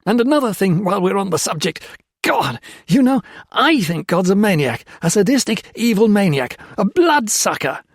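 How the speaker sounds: loud, high raging tirade; furious rant at the priest; berserk shouting. A narrator's reading. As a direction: scream your contempt at God. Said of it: "he shouted, berserk".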